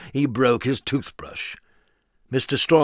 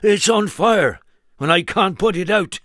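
higher, louder panicked farmer; worried farmer; 7 semitones higher, 6 dB louder than usual. Acted. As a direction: blurt it out in alarm. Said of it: "looking very worried".

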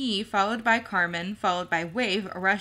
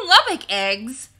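loud, slow, alarmed cry; shocked exclamation; 4 semitones higher, 9 dB louder than usual. A narrator's reading. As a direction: gasp it out in horror. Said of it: "Peter and Carmen exclaimed".

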